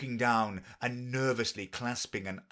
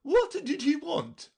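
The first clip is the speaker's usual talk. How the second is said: put-on a bossy queen lecturing the girl; Red Queen voice; 6 dB louder than usual. A narrator's reading. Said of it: "said the Red Queen".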